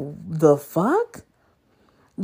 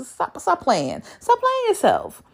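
exasperated; 9 semitones higher than usual. Real, not acted. Scolding the man in his profile.

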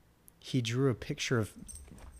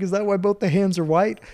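loud, raised accusing question; imitated instructor challenge; 8 semitones higher, 7 dB louder than usual. Drill instructor challenging recruit.

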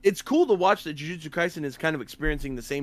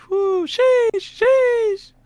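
high, loud hype squeal; excited anime fan; 7 dB louder, much slower than usual. Real, not acted. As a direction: cheer over a favorite character. Fan reacting to Boa.

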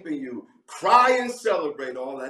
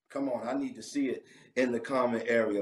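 ordinary talk, nothing marked

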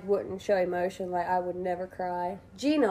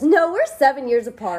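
proud reply; 5 semitones higher, 8 dB louder than usual. Retold or imitated. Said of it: "Gina very proudly goes".

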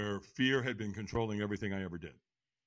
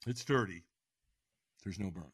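usual speaking voice

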